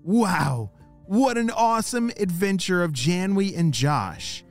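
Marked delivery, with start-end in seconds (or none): none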